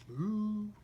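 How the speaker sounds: high drawn-out ooh; impressed ooh. Real, not acted. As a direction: coo in wonder. Reader reacts to picture.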